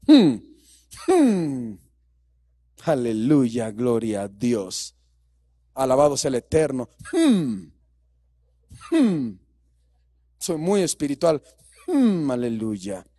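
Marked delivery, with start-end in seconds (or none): 2.9-13.1 low, slow, breathy-holy mock piety; mock pious voice. Preacher mocking a showy believer with low, slow mock piety. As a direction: ham up a falsely spiritual churchgoer.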